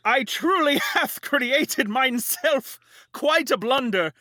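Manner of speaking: high, slow, hammed-up medieval lament; hammy stage actor. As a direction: wail it like a ham actor on stage. Woe is me, prithee.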